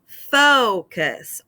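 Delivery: focus with a long, drawn-out FO; FO-cus, first syllable stressed. Shown as a demonstration. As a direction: stretch the O in focus, slowly. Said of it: "that first syllable ends with a long".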